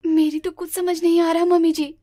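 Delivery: ordinary delivery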